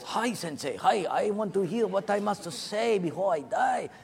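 light mock Japanese accent; eager Japanese-accented greeting. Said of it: "the Japanese guy goes".

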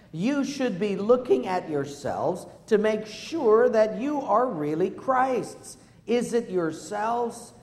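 loud, slow unison reading; congregational reading. Real, not acted. Pastor leads the congregation reading scripture aloud. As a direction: read it aloud together, slowly. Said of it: "Would you read it with me".

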